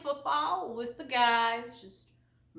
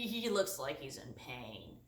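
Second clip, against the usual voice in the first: normal talking voice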